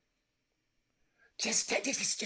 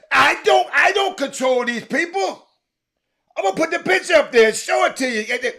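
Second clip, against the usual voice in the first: loud high frustrated shouting; loud high shouting, almost crying; heated shout; 7 semitones higher, 8 dB louder than usual. Real, not acted. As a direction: shout it, fed up and pleading.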